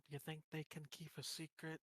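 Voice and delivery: hushed, voiced stage whisper; whispering to him. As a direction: ask it softly, for his ears only. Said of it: "whispers to him".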